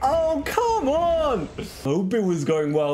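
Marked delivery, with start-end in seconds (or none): 0.0-1.5 high, drawn-out COME ON; exasperated wail. Real, not acted. Player fed up with the game, high wailed COME ON.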